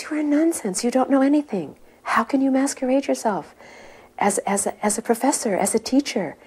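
high-pitched imitation of a guru's rebuke; retold heavy scolding. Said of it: "getting heavier and heavier with him".

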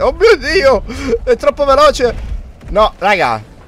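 panicked high cry; being chased. Real, not acted. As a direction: yelp in panic while fleeing. Beast too fast, fleeing.

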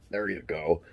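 ordinary talk, nothing marked

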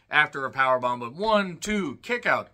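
usual speaking voice

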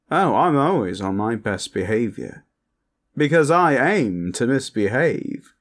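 mischievous posh vampire, lilting upward. Acted. Vampire teasing partner who asked for best behavior, playfully posh. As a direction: play a cheeky vampire who loves trouble.

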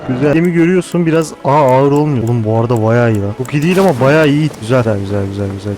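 usual speaking voice

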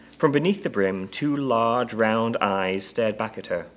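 ordinary delivery, nothing marked

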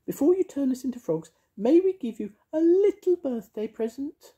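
high, sing-song witchy voice; bright, bossy little witch. Acted. Mavis voiced.

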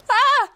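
a shriek of real surprise; real scream. Real, not acted. Caught unready by the attack, a high shrill scream.

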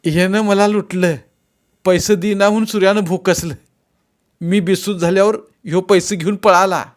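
high, loud complaint; accusing victim. A narrator's reading. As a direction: report the robbery to the officer.

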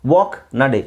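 ordinary talk

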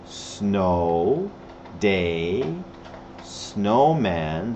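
soft, low "Snow", "day", "snowman", each sliding up; low rising drill. Shown as a demonstration. Teacher drilling six winter words, low soft rising tune.

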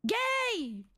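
gritos muy agudos. Acted. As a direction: grita arriba para que salte. (gritos agudos para hacer saltar al personaje)

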